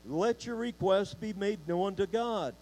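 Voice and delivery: ordinary delivery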